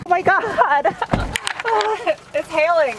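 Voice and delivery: high, laughing amazement; thrilled and stunned by the hail; excited disbelief. Real, not acted. Caught in sudden hailstorm.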